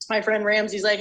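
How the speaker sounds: ordinary delivery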